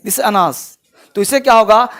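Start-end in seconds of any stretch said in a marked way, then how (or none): 0.0-0.8 "This is अनास" said as one flowing word "अनास"; don't stop after "आ", glide straight into "नास"; no pause after a. Shown as a demonstration. Teacher showing students the linked sound, smooth and unbroken. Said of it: "रुकना नहीं है".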